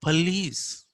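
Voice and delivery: police as "puh-LEECE", pitched high; short "puh" in high-pitched "police"; schwa. Shown as a demonstration.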